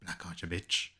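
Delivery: quick, soft, cocky Aes Sedai swagger; cocky Marion. Retold or imitated. Host voicing a cocky Marion to the co-host, soft and smug. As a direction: toss it off, smug and amused. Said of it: "Marion's out here cruising around".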